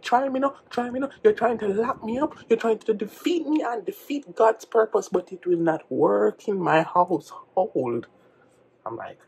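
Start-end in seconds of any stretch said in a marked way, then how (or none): 0.0-8.1 high, fervent imitation of a father rebuking; possessed, preachy dad. Dad rebuking his defiant son, fervent high rant. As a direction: declaim in raised pitch, drawing out the ending. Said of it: "you look more possessed than you do spiritual".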